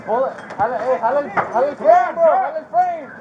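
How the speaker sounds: loud shouts in Spanish; urgent shout. Real, not acted. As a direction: bark orders over the noise. Pulling window frame in rescue.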